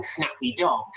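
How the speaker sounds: usual speaking voice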